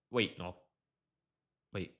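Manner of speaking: normal talking voice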